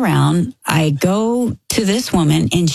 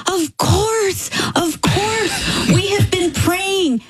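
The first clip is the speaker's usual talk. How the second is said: emotional son-in-law; 13 semitones higher, slower than usual. Retold or imitated. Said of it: "He goes".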